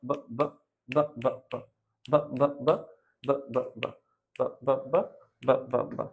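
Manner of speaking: ব repeated softly; phonics drill. Shown as a demonstration. Teacher drills the b sound for kids. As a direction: tap out ব again and again. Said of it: "বি sound ব".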